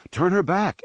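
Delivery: high-pitched eager call. A narrator's reading. Gunner calling to the pilots to turn, high urgent call. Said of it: "Anakin called".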